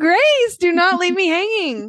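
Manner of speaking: high, insistent playful protest; mock pleading. Co-host begging Grace for the accent, high-pitched mock pleading.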